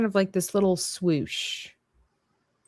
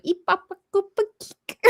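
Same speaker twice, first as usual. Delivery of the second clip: squeaky high gibberish; mock babble, high and silly; high nonsense chatter; 12 semitones higher than usual. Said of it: "it just sounded like".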